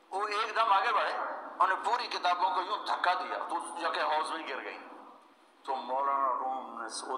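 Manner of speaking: usual speaking voice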